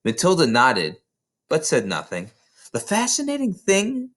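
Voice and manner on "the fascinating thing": high, lifted with fascination; eager wonder. A narrator's reading. Miss Honey marvels to Matilda about her power, high and eager. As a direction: let the idea thrill her.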